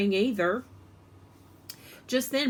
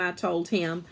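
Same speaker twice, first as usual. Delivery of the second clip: usual speaking voice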